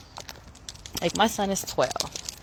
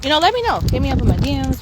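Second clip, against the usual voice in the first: excited sign-off; 7 semitones higher, 8 dB louder, faster than usual. Real, not acted.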